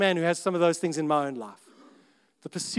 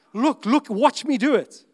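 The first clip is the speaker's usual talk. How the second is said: show-off gym-goer; 7 semitones higher, 8 dB louder, slower than usual. Retold or imitated. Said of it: "It's like".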